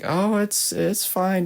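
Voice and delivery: retold bike rider, pitched well up; imitated easygoing "it's fine"; high-pitched retold reassurance. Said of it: "And he's like".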